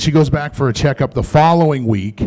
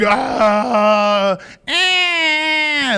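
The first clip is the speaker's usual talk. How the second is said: mock labor groan; 11 semitones higher than usual. Imitating mom in labor.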